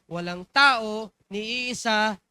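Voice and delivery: loud, slow emphasis; emphatic. Acted. Pastor stresses point loudly.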